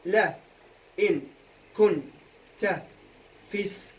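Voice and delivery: isolated syllables with clear gaps; syllable-by-syllable scansion. Shown as a demonstration. Teacher demonstrating how to scan a verse, chopped syllable by syllable. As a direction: cut the verse into separate beats. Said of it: "هسا أول سؤال كالعادة قطع".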